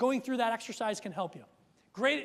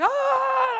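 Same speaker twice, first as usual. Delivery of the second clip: loud, much higher mock scream of frustration; exasperated scream; 11 dB louder than usual. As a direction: let out a fed-up dad's scream. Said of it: "And I'm just like".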